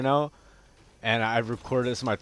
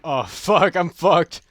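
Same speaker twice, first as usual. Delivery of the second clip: loud, raised-pitch dismay; alarmed swearing; 8 semitones higher, 11 dB louder, slower than usual. Real, not acted. Gamer realizes he is doomed in the match, alarmed swearing. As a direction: cry out, knowing the fight is lost. In trouble in game.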